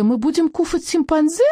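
put-on very high little-kid voice with a lisp; Жан Д squeaking. A narrator's reading. Small boy asks about eating monkey, squeaking. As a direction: squeak it out, childish lisped question. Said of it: "пропищал Жан Д".

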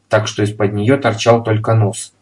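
usual speaking voice

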